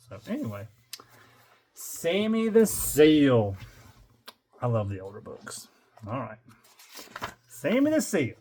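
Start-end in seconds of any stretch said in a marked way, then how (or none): 1.7-3.6 title announcement. Acted. 7.5-8.3 title announcement. Acted. Announcing the book title again.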